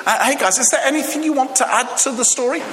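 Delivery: high, fast smug voice; smug Peter. Retold or imitated. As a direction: needle his friends, high and quick. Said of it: "looked at James and John and Andy and said".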